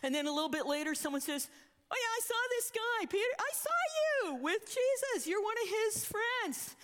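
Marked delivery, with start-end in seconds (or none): 1.9-6.8 high-pitched mock accuser, calling out; excited accusation. Bystander accuses Peter of knowing Jesus, excited accusation. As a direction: point someone out loudly, pitched well up.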